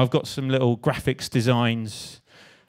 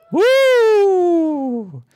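high loud woo; cheer; 8 dB louder than usual. Real, not acted. Cheering his girlfriend in the audience with a high, loud whoop.

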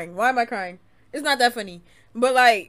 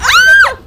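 very high, loud scream; piercing high yelp of alarm; sudden scream; 17 dB louder than usual. Real, not acted. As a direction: scream out in shock. Scream in a live game.

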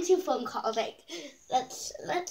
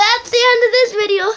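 loud, high wailing announcement; hammed-up sign-off; 6 semitones higher, 12 dB louder, faster than usual. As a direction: belt out the closing line high and loud. Hammed-up sign-off after challenge.

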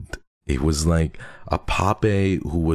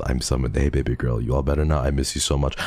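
ordinary delivery

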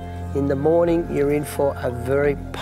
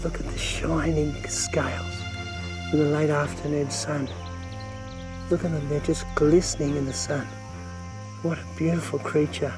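hushed awe; 2 semitones lower, 6 dB quieter than usual. Real, not acted. Admiring scales in sunlight.

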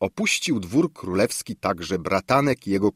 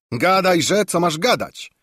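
much higher, louder rough-mannered shout; coarse shout; 9 semitones higher, 5 dB louder than usual. A narrator's reading. Bałaguła hurrying his friend along, coarse loud shout. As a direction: bark it out bluntly. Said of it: "wykrzyknął rubasznie kolega Bałaguła".

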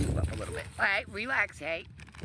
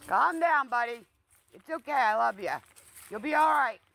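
soothing the dog; 7 semitones higher, 4 dB louder than usual. Real, not acted. Owner calming his barking dog, soothing and high. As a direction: settle the scared pup gently.

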